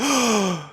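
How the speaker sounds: high, sharp intake of breath; shocked gasp. Retold or imitated. Chopper reacts to Stanson's injury, gasping. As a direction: gasp in horror.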